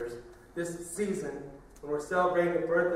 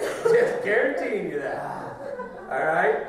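slow, louder, laughing; laughing words; 3 semitones higher, 10 dB louder, much slower than usual. Real, not acted. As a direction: draw it out with a laugh. Laughing while speaking.

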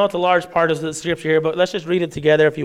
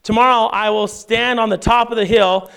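measured, raised reading of the passage; scripture reading; 4 semitones higher than usual. Acted. Pastor reads Exodus aloud to the church, measured reading voice. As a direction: read the King James text to the congregation.

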